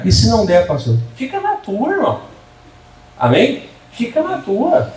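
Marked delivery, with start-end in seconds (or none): 1.2-2.2 pitched higher, a curt brush-off; pastor's blunt answer. Acted. Pastor answering the church member, high curt brush-off. 3.9-4.9 pitched higher, a curt brush-off; pastor's blunt answer. Acted. Pastor answering the church member, high curt brush-off.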